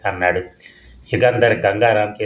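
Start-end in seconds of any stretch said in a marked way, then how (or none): none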